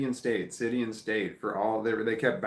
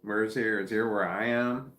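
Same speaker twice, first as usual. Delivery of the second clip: usual speaking voice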